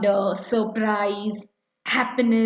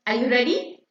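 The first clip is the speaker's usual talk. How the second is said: ordinary talk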